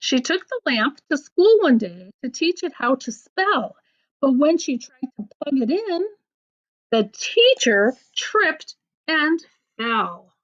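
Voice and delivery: lively storybook reading with voiced bits; picture-book read-aloud; reading to children. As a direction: read the silly poem to a child. Reads a funny rhyme book aloud.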